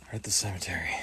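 hushed whisper at the graves. Real, not acted. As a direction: whisper so the spirits hear. Quiet arrival at the graveyard.

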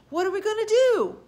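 high, loud desperate question; panicked wail. A narrator's reading. Oliver panics in the bottle.

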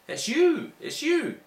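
high, loud preacher's cry; fervent exclamation. Real, not acted. Preacher calling out to his listeners, loud and fervent. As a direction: proclaim it to them with fire.

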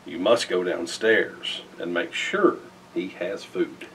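ordinary delivery

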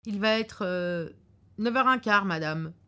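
hesitant driver answering madame; lightly voiced chauffeur; chauffeur's answer.

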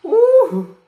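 high, loud oh; sudden startled exclamation; surprised gasp. Real, not acted. Surprised at the new level. (شهقة مفاجأة)

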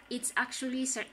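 ordinary delivery

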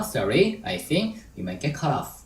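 slow, with pauses between chunks; deliberate, phrase-by-phrase English demonstration; slow drill model. Shown as a demonstration. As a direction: model it slowly for learners to repeat. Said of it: "천천히 해볼게요".

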